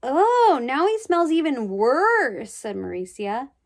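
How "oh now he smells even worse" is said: high, slow, grossed-out whine; disgusted girl. Acted. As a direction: drag it out in disgust.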